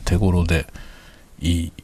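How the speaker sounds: normal talking voice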